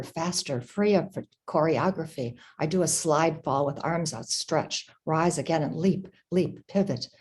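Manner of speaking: ordinary delivery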